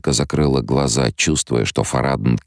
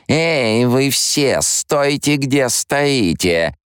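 much higher, commanding, last words very slow; a mocking imitation of a command voice; parody of the Voice; 12 semitones higher, much slower than usual. Alia freezing everyone with a mock Bene Gesserit command. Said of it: "пародию на голос Бины Гессерит".

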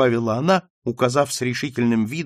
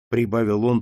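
ordinary delivery

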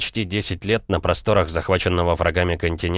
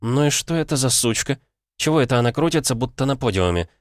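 displeased woman; 6 semitones higher than usual. Acted. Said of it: "недовольно смотрела".

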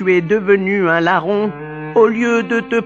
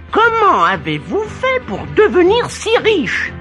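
amazed question; 10 semitones higher than usual. Acted. Amazed father asks.

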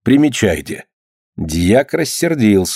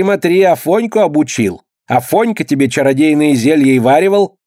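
snide; 5 semitones higher than usual. Acted. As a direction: needle him with a sly sneer. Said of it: "ехидно так говорит".

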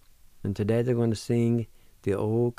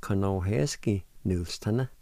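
"Conohesky Nulstana" as two flowing words; joined-up pronunciation. Shown as a demonstration. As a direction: run the syllables together naturally. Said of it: "and together".

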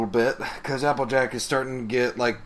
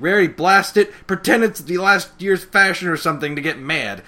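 higher, louder mock bark; retold frustrated shout; exasperated urging; 6 semitones higher, 7 dB louder than usual. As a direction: imitate an impatient teammate egging on a friend. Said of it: "and she's like".

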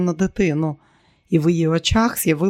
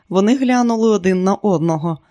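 ordinary delivery, nothing marked

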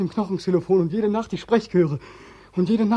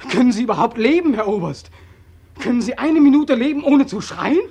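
accusing outburst; 5 semitones higher, 5 dB louder than usual. Acted. Accusing the colonel, rising outburst.

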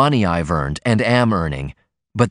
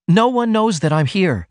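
protesting; 9 semitones higher than usual. A narrator's reading. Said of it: "she protested".

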